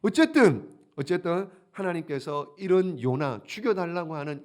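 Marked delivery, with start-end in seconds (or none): none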